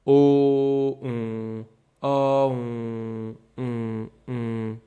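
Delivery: teacher contrasting O and on; O then nasal on. Shown as a demonstration. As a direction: open O, then close toward on through the nose. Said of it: "engaging my nose".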